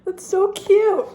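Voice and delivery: very high, loud, drawn-out coo; gushing squeal. Real, not acted. Reader melting over a cute page, squealing. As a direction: squeal it slowly, melting at the cuteness. Overwhelmed by cute scene in book.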